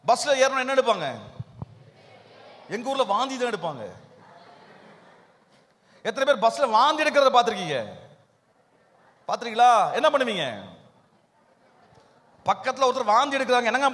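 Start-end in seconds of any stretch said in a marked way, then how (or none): none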